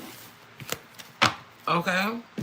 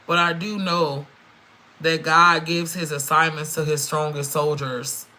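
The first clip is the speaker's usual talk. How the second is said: usual speaking voice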